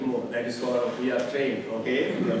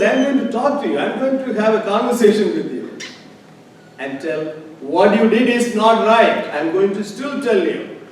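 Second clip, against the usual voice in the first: loud, high, determined declaration; defiant declaration; 8 semitones higher, 13 dB louder than usual. Retold or imitated. Preacher voices the grieving patient confronting God loudly. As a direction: lift the voice and insist she will confront God.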